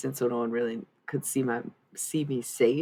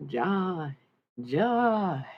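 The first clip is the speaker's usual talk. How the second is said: drawn-out Southern holler, rising higher; Alabama accent; 5 semitones higher than usual. Acted. Drunk partying with Alabama friends, mock Southern accent. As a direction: call out the name with an Alabama twang. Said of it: "party with people from Alabama".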